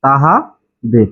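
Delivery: তাহাদের said slowly and drawn out; sadhu form slow. Shown as a demonstration. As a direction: stretch তাহাদের syllable by syllable. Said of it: "সাধু ভাষায় সেটা হয়ে যাবে". (তাহাদের ধীরে টেনে টেনে)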